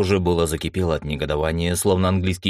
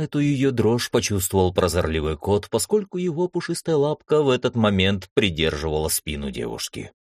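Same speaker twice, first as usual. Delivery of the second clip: ordinary delivery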